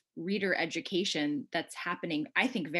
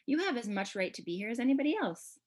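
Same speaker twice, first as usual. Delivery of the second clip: firm and insistent, pitched higher; 6 semitones higher than usual. A narrator's reading. Shamsa tells Allie she has a right to be here, firmly. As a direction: insist on it with a lifted voice. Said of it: "Shamsa says firmly".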